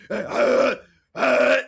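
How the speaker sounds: loud, high strangled gasp; winded gasping. Acted. Tackled kid on the ground, loud winded gasping. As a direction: gasp for air with the wind knocked out.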